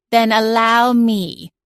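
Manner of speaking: slow, grumpy talking cat; cranky cat voice. A narrator's reading. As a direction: drag it out, put out and irritable. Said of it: "a cranky voice said".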